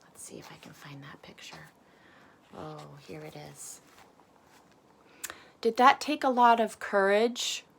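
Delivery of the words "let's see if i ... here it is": soft unvoiced whisper while searching; whispered aside. Real, not acted. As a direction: mutter it under your breath.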